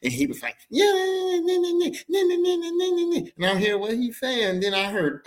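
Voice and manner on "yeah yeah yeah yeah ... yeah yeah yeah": high-pitched mocking yammer; exaggerated sassy falsetto; sassy uncle. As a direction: squeal out sassy nagging over the phone. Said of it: "he was sassing my mom".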